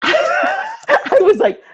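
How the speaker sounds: a loud, high burst of laughter before the words; big laugh. Real, not acted. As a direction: laugh out loud, shocked and amused. Reacting to the fundraiser news.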